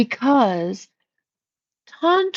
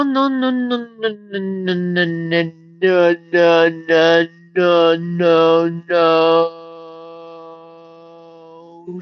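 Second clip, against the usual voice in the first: running string of refusals, sliding steadily lower; drawn-out refusal; 6 semitones lower, slower than usual. Real, not acted. Streamer shutting down chatter's tantra talk, descending refusal. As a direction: rattle off a long chain sinking in pitch.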